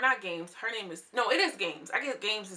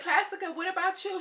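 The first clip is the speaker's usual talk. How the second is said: high mock voice; 9 semitones higher than usual. Quoting a reality show cast member.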